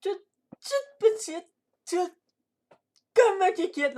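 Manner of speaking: high, squeaky put-on voice; piping high-pitched intro voice; silly intro greeting. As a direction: greet the viewers in a tiny high voice.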